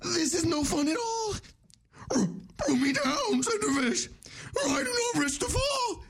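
high, cracking stoner panic; Shaggy voice. Acted. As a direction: yelp it like a scared Shaggy. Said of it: "next up is Shaggy and Scooby-Doo".

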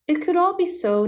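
put-on understated boyish complaint; mild lament. A narrator's reading. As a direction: lament it lightly, pitched well up. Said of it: "the boy lamented".

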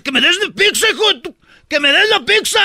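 mock angry demand. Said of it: "el vato bien enojado dice".

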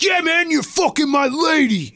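high, strained accusing mock voice; angry husband. Jealous husband accuses Matt at the door, high mock accusation. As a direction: accuse him like a jealous thug. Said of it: "he's like".

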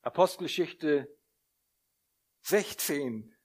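ordinary delivery

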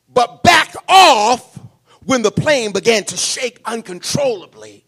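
preaching shout. Acted. Preaching story at full force.

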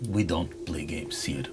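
low, flat whispered refusal; hushed, unfriendly shopkeeper; cold whisper. Acted. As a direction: shut them down in a hushed voice. Merchant refuses coldly.

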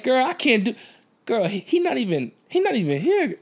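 high, mocking girl's voice. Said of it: "she like".

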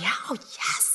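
breathless whispered yes; eager whisper. Retold or imitated. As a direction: hush it like a rapt audience.

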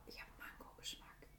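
soft secret whisper. Acted. Secret aside about mango.

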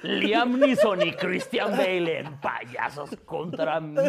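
lento y dramático de tráiler; voz de tráiler. Pretend. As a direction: anuncia el estreno lentamente. Tráiler de película. (voz de tráiler lenta y dramática)

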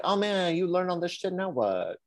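high-pitched, lightly acted complaint; mock dismay. Host voicing student whose company dropped the plan, mock dismay. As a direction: whine lightly on the student's behalf.

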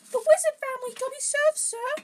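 very high, loud squeaky elf; Dobby. A narrator's reading. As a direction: answer in a squeaky falsetto. Reader acting Dobby's answer.

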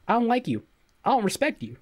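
high, loud blunt jab; blunt mock insult. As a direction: deliver the insult with cheeky bluntness. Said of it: "I'm like".

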